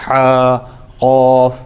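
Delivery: ہا and قوف squeezed out of a tightened throat; pinched h in ہا, heavy q in قوف; hard, pressed sound. Shown as a demonstration. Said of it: "اسی کو ہم کہتے ہیں سخت آواز".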